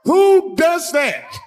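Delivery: high, slow, stretched outrage; outraged disbelief. Real, not acted. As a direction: squeal it in shocked disbelief. Outraged punchline.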